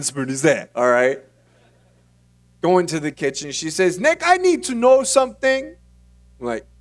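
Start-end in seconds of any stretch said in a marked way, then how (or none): 4.0-5.8 high, loud imitation of mom; worried mom. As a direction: play an anxious mother starting an interrogation. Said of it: "She was pretty angry".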